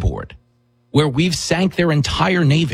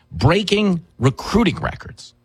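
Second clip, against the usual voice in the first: usual speaking voice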